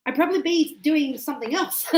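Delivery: chuckling through the joke; laughing. Real, not acted. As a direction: laugh at your own joke. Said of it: "joking about another job".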